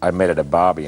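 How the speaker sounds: usual speaking voice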